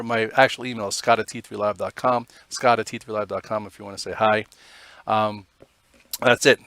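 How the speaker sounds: normal talking voice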